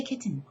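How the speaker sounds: "kitten" as KIT-ten, T sounded; disowned pronunciation. Shown as a demonstration. Teacher repeating what they don't say, "kitten" with a sounded T. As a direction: give "kitten" a released T. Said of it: "I don't say kitten".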